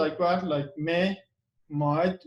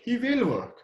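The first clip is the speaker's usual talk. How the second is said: "will" raised high, falling away on "work"; stress on WILL; 6 semitones higher than usual. Shown as a demonstration. Answering the claim he can't work, insistent stress on "will". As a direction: counter firmly with a high "will". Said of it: "pronounce will, it should be with stress".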